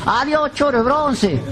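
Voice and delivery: high, slow taunting call; a hammed-up high jeer from the bar; mock jeer. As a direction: drag out the insult, high and mocking. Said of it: "Pasa por el boliche".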